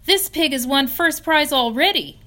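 high, loud urgent news; alarmed announcement. Acted. Boy pointing out the rival's prize, high and loud. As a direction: sound the alarm.

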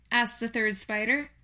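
ordinary delivery

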